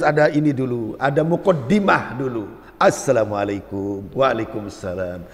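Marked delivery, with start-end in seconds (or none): 2.8-4.1 much lower, soft courteous greeting; soft polite greeting. Retold or imitated. Preacher greets passenger on bus, soft and polite. 4.1-5.2 much lower, slow drawn-out reply. Retold or imitated. Bus passenger returns greeting, slow and low.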